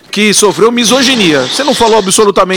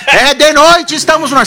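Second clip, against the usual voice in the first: high-pitched mock show intro; put-on on-air opener, raised pitch; TV show opening; 7 semitones higher than usual. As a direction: imitate a host announcing the show, pitched up. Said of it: "naquele programa seu lá".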